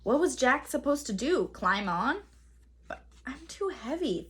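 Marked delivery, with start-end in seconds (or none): 2.9-4.3 soft, hushed worry under the breath; inner thought. A narrator's reading. Jack doubting he can ride the pteranodon, soft and hushed. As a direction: voice the doubt quietly to yourself. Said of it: "thought Jack".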